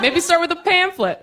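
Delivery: high-pitched mock-helpful suggestion; snide suggestion. Snide advice to memoir writer.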